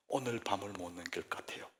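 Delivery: 울먹이며 속삭이는 사모님 흉내; imitating a tearful whisper. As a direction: hush it like someone choking back tears. Retold weeping wife's call.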